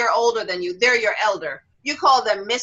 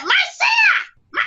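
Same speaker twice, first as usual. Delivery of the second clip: put-on very high child's shout; indignant little girl; 4 dB louder than usual. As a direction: imitate an insistent five-year-old calling out.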